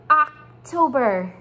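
teacher repeats "October" for the class; model word again. Shown as a demonstration. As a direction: repeat "October" slowly and firmly. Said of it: "Say it one more time".

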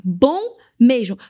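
"bom" drawn out slow and loud; heartfelt emphasis. Real, not acted. Leader vouching for the lasting relationship, heartfelt emphasis on "bom". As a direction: lean hard and long on "bom", then finish "mesmo". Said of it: "Falo isso daqui com todo o meu coração".

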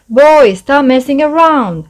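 loud, high call across the water; loud call to the squabbling goblins. A narrator's reading. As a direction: call from the lakeside to stop them. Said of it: "called Louis from the side".

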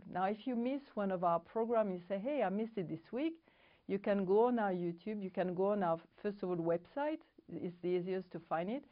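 ordinary talk, nothing marked

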